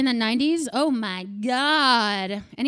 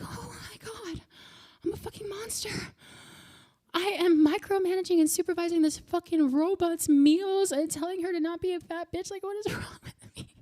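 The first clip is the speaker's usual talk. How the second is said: horrified self-realization; 6 semitones higher than usual. Retold or imitated. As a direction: catch yourself being monstrous. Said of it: "and I'm like".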